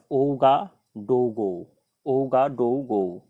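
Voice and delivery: ओगाडोगो drawn out, syllable by syllable; slow careful capital name. Shown as a demonstration. Teacher slowly spelling out a hard capital for students. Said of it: "तो ओगाडोगो ये इस बुर्किनांग Faso देश का capital है".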